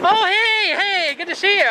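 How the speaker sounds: high-pitched, excited hello; delighted greeting. Real, not acted. Vlogger greets an officer he knows, high and excited. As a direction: greet a familiar face with real excitement. Surprise meeting with an acquainted officer.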